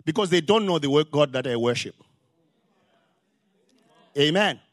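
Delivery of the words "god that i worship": ordinary talk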